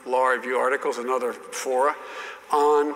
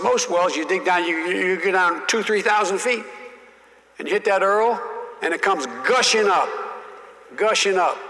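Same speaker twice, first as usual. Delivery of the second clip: folksy senator explaining; 5 semitones higher than usual. Retold or imitated. Senator lecturing the young colleague on oil wells, hammed-up. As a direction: patiently explain like an old senator. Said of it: "he said".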